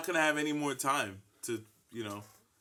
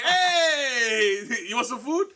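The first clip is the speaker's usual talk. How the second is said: guilt tripping grandma; 9 semitones higher, 4 dB louder than usual. Retold or imitated. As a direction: coax him to eat like a worried mom. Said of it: "mom's gonna be like".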